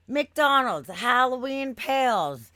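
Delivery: slow, loud, emphatic reading of the ad. Retold or imitated. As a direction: read it out like proof on a sign. Reading the official ad aloud.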